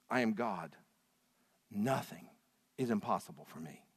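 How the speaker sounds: a hint of a low, quiet, solemn God. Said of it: "God said this to the prophet Jeremiah".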